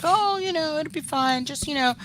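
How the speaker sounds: high light mock parent voice; breezy, casual parents reassuring. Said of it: "and they were like".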